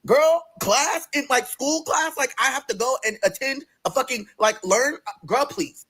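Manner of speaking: high, drawn-out disbelief; incredulous. Retold or imitated. Patient told they have to attend class, incredulous. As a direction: question it as if it's absurd.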